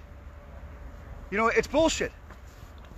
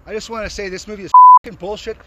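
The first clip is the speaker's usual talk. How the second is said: angry shouting; 11 dB louder than usual. Real, not acted. Angry protester confronting filmmakers.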